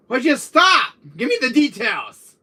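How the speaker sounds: high, loud demand; worked-up high yelling; agitated outburst. Acted. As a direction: burst out loudly at your brother. Outburst at the brother's confession.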